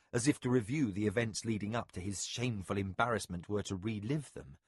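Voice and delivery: ordinary delivery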